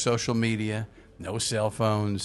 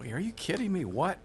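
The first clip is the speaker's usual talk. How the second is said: jumps much higher in shock; stunned disbelief; 11 semitones higher than usual. Retold or imitated. DJ recalls the hotline call, stunned and pitched high. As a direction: retell the moment the news landed, amazed. Hearing [name] had died.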